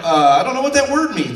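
high, loud mock bafflement; baffled simpleton. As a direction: play dumb at the big word. Acts himself as baffled simpleton.

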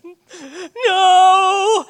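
put-on high, loud wailing little boy's no; crying child's denial. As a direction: wail it like a caught, hurt kid. Child lying while crying.

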